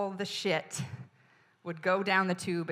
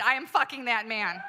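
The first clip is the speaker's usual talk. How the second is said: slightly raised, closing yell; yelled, winding down; 6 semitones higher, 4 dB louder than usual. Retold or imitated. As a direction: land the last line a bit less fiercely. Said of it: "I yelled at him".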